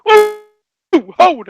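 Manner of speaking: high, drawn-out "ooh" dropping into "hold"; mock thrilled exclamation, starting high; retold excited whoop. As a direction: imitate the amazed cry from a video. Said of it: "I, I was like".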